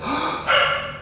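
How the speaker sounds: big gasping inhale; sharp gasp. Shown as a demonstration. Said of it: "I'm gonna do like".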